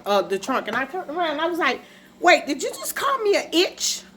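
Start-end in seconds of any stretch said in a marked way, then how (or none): 2.2-4.0 high-pitched re-enacted indignant question; acted-out affronted double take; shocked disbelief. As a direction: ask it way up high, incredulous. Re-enacts her shocked reaction to insult.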